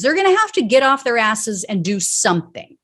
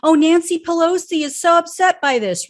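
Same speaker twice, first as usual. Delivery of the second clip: very high, sarcastic mock-concerned voice; ridiculing politicians who only pretend to care; mocking fake concern; 8 semitones higher than usual. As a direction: lay the phony sympathy on thick, pitched way up. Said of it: "She's no different than frickin' Susan Collins".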